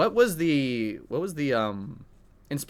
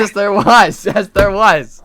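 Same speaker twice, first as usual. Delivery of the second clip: loud, laughing insistence; loud insisting; 7 semitones higher, 8 dB louder, slower than usual. Real, not acted. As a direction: insist loudly, teasing. Teasing argument, loud insist.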